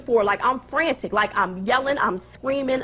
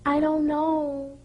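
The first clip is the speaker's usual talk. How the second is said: imitating Pinky; 4 semitones higher than usual. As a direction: drag it out in a higher, unconcerned voice. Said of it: "She said".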